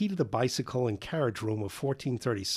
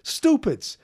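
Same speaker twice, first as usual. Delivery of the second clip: a sharp high yell, much louder; 13 semitones higher, 5 dB louder than usual. A narrator's reading. Janitor yells at the loitering boys, loud and high. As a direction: bark the word out high. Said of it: "he yelled".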